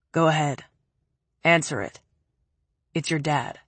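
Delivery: a hint of a low, cold man. Preppy's cold voice.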